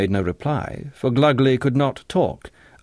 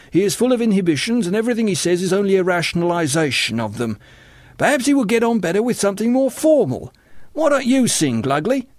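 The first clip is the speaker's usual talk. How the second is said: high, quick chatter turning to invitation; high-pitched superior young man; condescending Gus; 10 semitones higher, 4 dB louder than usual. A narrator's reading. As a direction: rattle off the analysis, pitched high. Gus explains John, invites Glugly.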